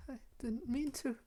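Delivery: put-on high, soft, timid girl's voice; small, high, halting and hushed; frightened Yuri. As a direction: plead softly in a high, fragile voice.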